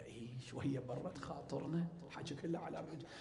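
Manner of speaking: whispered aside. Retold or imitated. Wife coaxing her husband privately, whispering. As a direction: lean in and whisper it like a confidant.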